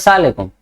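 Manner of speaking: सलाम अलैकुम run together as "saalekum"; swallowed greeting. Retold or imitated. Child saluting the teacher, सलाम अलैकुम slurred into "saalekum". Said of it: "अपने अंदर ही कहते".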